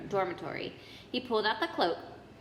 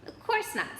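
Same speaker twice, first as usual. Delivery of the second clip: brisk; 12 semitones higher than usual. A narrator's reading. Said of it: "said Hermione briskly".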